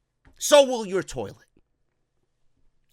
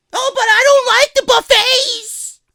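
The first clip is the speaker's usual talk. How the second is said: much higher, loud put-on wife; wife complaining about the buffet; whiny wife voice; 11 dB louder than usual. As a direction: whine it in a high silly voice.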